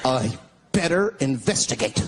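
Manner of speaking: a hint of a naive horror movie hero.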